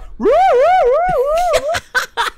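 high loud cackle; a shrieking laugh; burst of laughter. Real, not acted. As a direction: crack up out loud. Laughing at dinosaur antics.

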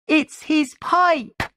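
put-on very high little-girl cry; high-pitched, eager shout; excited shout. A narrator's reading. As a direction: call it out high, like an excited child. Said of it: "shouted my little sister".

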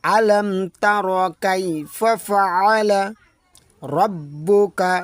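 slow, chanted verse, lifted; Quran recitation. Shown as a demonstration. Teacher repeats the verse for the class.

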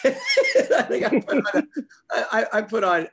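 real laughter breaking up the words; stumbling over words while laughing; giggling, losing the thread. Real, not acted. Laughing at own confusion.

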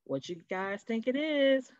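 ordinary delivery, nothing marked

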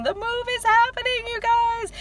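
high, loud excited squeal. Real, not acted. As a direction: squeal the news with glee. Excited announcement of the move.

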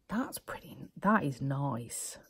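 slow, soft whisper over swatches; ASMR whisper. Real, not acted. Vlogger whispers to viewers while swatching, slow hushed whisper. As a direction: hush it like an ASMR video.

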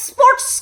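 স্পোর্টস mocked as "es-sports"; mock mispronunciation. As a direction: add a scornful "es" at the front of স্পোর্টস. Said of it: "তুই নিজে উচ্চারণ ঠিক করে করস". (স্পোর্টস-কে তাচ্ছিল্য করে "এসপোর্টস")

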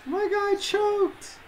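slow, soft, high-pitched squeal; mock dismay. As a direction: tease in a tiny high whine. Teasing, then "just playing.

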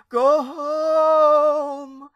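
aunt's wail ringing across empty streets. Acted. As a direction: let the wail soar and carry far. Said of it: "they heard her wail".

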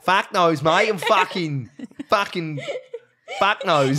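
mock Australian, halting and amused; Australian accent. Answering co-host in Australian, hammed-up Australian accent. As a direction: stumble along in broad Australian. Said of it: "little conversation in Australian".

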